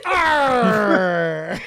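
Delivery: loud, drawn-out pirate "Arr"; pirate growl. Pretend. Host cheering on the pirate act, pirate shout. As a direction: roar a hammed-up pirate cry. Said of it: "there's a lot of R in harbor".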